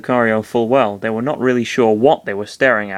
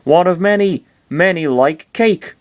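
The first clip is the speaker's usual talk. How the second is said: high, bouncy little creature squeak; high-pitched cute creature voice; cartoon creature; 7 semitones higher, 3 dB louder than usual. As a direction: pipe it up like an odd little critter. Same creature keeps talking.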